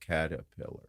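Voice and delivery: low, soft, tentative attempt at caterpillar; sounding it out. Child tries a new word, imitated sounding out. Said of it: "he'll try and sound it out".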